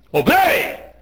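high, far-carrying preacher's shout; shouted command. Real, not acted. Preacher commands the tithe-withholders to obey, shouting. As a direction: cry it out as an order.

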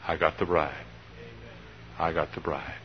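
low, soft report to the Father; quiet, reverent triumph; hushed reverence. Acted. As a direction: say it softly and humbly, fading. Imagined tender report to the Father.

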